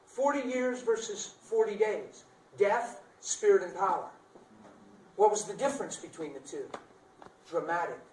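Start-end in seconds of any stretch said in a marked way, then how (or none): 0.1-4.1 slow, high, deliberate words; slow emphatic contrast. Acted. Preacher to congregation, slow high emphatic contrast. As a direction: spell out the contrast slowly.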